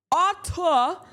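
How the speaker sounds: high, stiff mock robot voice; hammed-up robot word. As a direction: say it like a squeaky robot. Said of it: "That's not how I fucking talk".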